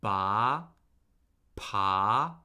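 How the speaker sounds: "Ba" and "pa" starting low and rising; second tone. Shown as a demonstration. Teacher drilling the second tone, rising pitch on "Ba, pa". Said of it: "the rising tone".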